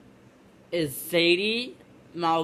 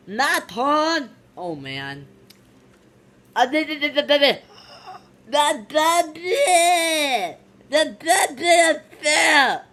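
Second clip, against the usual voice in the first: put-on higher, blurting baby-crazed cries; excited; 8 semitones higher, 5 dB louder than usual. Eager visitor getting a turn with the baby, excited. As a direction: burst out with stammering excitement.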